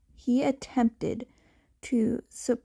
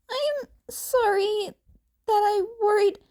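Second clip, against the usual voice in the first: Izuku's voice; 10 semitones higher than usual. Acted.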